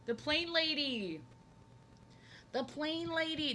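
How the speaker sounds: slow, high, drawn-out delighted call; excited recognition. Real, not acted. Streamer reacting to chat mentioning the plane lady, delighted. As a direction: savour the name with glee.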